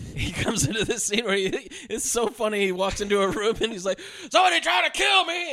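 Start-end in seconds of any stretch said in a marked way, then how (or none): 4.3-5.5 very high, loud mock shout; hammed-up hysterical holler; imitated frantic yell. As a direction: burst in yelling in comic panic. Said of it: "he yells at his girlfriend".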